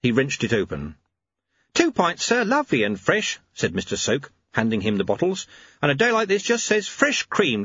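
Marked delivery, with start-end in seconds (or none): 1.7-3.4 high, chirpy milkman voice; bright sing-song delivery man; cheery milkman. Acted. 5.8-7.6 high, chirpy milkman voice; bright sing-song delivery man; cheery milkman. Acted.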